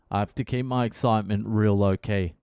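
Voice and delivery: normal talking voice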